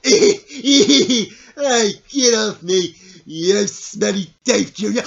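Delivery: loud, laughing complaint; mock protest. Dog jumping up during a tickle, loud mock protest. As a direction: push the dog away with playful outrage.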